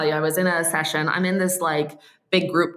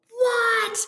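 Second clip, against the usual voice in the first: high, shocked disbelief; stunned what. Retold or imitated. Coaching group watching Dan cold call, shocked. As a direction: squeal of disbelief.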